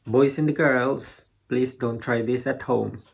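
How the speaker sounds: ordinary talk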